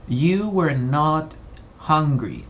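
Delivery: very slow, each word set apart for learners; slow and clear for students; slow model sentence. Shown as a demonstration. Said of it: "Veámoslo de la siguiente forma".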